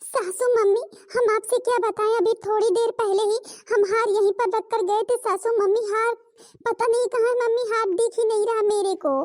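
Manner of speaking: put-on distressed young daughter-in-law; panicked young bride. Daughter-in-law's missing necklace panic.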